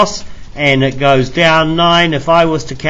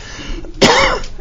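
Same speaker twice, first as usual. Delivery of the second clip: sharp chesty cough; a hard rough cough from the chest. Real, not acted. Teacher coughs.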